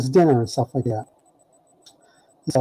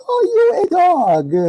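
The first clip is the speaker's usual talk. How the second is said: much higher, slow, gleeful taunt; mock taunt; 4 dB louder, slower than usual. Faley tells the teller again he ate dog, gleeful and high. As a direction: savour telling him he ate it.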